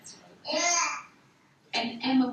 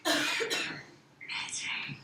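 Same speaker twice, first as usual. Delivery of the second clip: soft whispered approval; whispered praise; 4 dB quieter than usual. Real, not acted.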